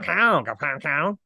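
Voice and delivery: a mock Cajun honk on "cow"; a playful Cajun twang honked on every "cow"; twangy honking. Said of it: "a fucking twangy honking".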